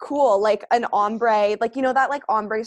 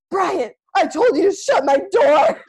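imitating Aubrey yelling, louder and higher; mock yell at brother; 6 semitones higher, 7 dB louder than usual. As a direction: snap at the brother through the door, hammed up. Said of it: "She's like".

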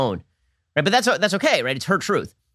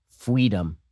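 mock toddler lisp; 6 semitones lower than usual. Imitating toddler saying fweedom.

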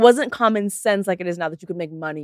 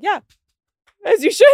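approving bystanders; 12 semitones higher, 4 dB louder than usual. Retold or imitated. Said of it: "Everyone was like".

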